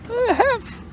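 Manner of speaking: very high, gasped broken word; breathless Piglet. A narrator's reading. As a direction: try to get it out while out of breath. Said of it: "breathing so hard that he could hardly speak".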